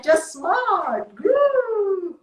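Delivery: high, bubbly put-on voice swooping way up on groove; cheery coaching. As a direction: sing it out like a peppy cheerleader. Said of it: "they told them from morning to night".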